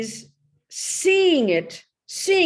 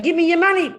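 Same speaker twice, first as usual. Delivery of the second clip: mock mugger yell; 7 semitones higher, 7 dB louder, faster than usual. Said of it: "He kept saying".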